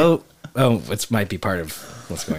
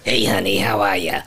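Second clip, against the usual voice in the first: coffee talk voice; 2 semitones lower than usual. Retold or imitated. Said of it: "Kinda like the coffee talk voice".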